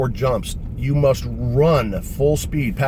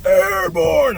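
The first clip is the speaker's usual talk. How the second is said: high, loud, drawn-out holler; shouted call; 5 dB louder than usual. Retold or imitated. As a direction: holler it across a field. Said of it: "all of a sudden I hear this".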